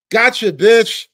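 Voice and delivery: normal talking voice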